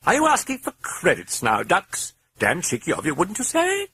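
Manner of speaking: high, mocking British accent; cheeky British voice. A narrator's reading. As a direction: tease the priest. British voice teases the priest.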